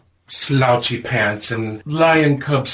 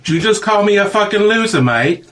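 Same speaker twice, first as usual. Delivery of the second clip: Australian mate voice; 9 semitones higher, 4 dB louder than usual. Acted. Space Baby Aussie character retort.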